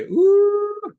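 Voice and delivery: high, loud "ooh"; big delighted ooh, held high; impressed whoop. Shown as a demonstration. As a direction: let out a soaring, wowed ooh. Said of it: "this is what, how you do it".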